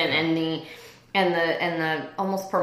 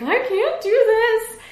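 much higher, slow, pleading I can't do this; panicked plea; 5 dB louder, slower than usual. Retold or imitated. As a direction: whimper it, overwhelmed. Panicking on call to friend.

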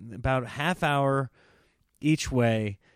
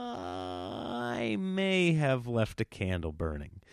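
slow, soft imitation of a sheepish admission; reluctant confession; 5 semitones lower, 5 dB quieter, slower than usual. Imitating wife confessing about a candle, slow and sheepish. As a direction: draw it out quietly, owning up reluctantly.

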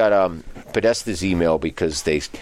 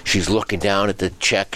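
normal talking voice

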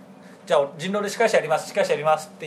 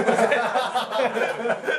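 stifled giggles; 5 semitones higher than usual. Real, not acted. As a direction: burst of held-in laughter. Recalls snort of laughter.

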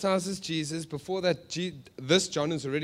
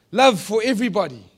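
mock message; 5 semitones higher, 9 dB louder than usual. Sarcastic false answer to own question.

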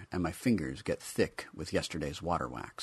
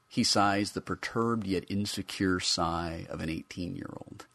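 ordinary delivery

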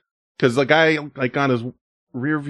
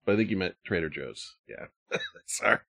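ordinary talk, nothing marked

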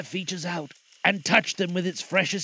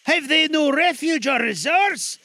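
put-on high, loud desperate old man; crying out; 10 semitones higher, 7 dB louder than usual. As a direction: cry out in dismay. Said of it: "cried Scrooge".

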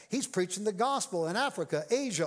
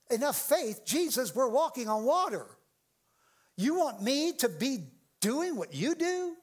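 higher-pitched, incredulous questioning; shocked disbelief; 5 semitones higher than usual. Acted. Preacher answering Jesus about walking on water, shocked protest. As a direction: push back in disbelief, stretching the last question. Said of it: "that shocks me".

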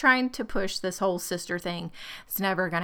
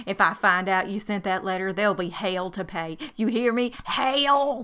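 drawled hammed-up Texas menace; comic Texan warning, hell stretched out; mock Texas threat. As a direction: growl it like a Texas mama. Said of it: "She's like".

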